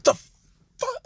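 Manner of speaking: very high, loud yelp of outrage; outraged outburst. Acted. Melissa reacting to Izuku's insult, high outraged outburst. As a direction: burst out high and indignant.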